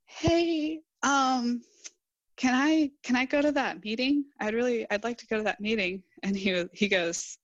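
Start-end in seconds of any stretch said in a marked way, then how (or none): 0.1-6.0 timid, higher-pitched request with hesitations; small, tentative re-enacted voice. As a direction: ask shyly, faltering on the way in. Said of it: "kind of timidly, I was like".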